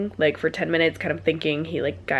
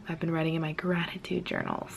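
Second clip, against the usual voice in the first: whispering; 8 dB quieter than usual. Real, not acted. Late night, whispered line.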